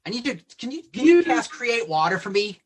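high, loud pleading; urgent plea. Acted. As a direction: beg for the spell. In-game plea for magic.

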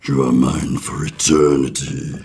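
very low, slow booming god voice; deep planet voice. Acted. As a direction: rumble it out like a giant planet. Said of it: "And he says".

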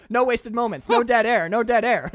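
loud, high mock-strict producer; mock producer boss. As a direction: bark it like a fussy radio boss. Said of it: "let's get back on topic".

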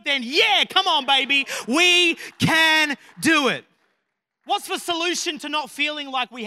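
loud, driving sermon delivery; full-force preaching. Acted.

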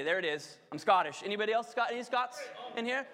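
ordinary talk

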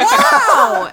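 high, loud, laughing exclamation; amazed wow. Retold or imitated. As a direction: re-enact a big astonished wow. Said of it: "I'm like".

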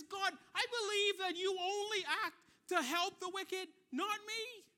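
high, loud accusation; frustrated yelling. Retold or imitated. Job accuses God of favoring the wicked, frustrated yelling. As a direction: voice an aggrieved complaint hurled upward at God. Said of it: "And he's frustrated at God".

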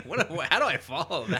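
high, loud, through laughter; cracked up, barely able to go on; real laughing exasperation; laughing disbelief. Real, not acted. Laughing after partner's heckler bit.

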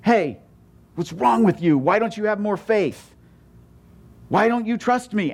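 high, scolding mock yell; hammed-up exasperated rebuke. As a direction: imitate a scolding Jesus who never was. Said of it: "Jesus isn't yelling".

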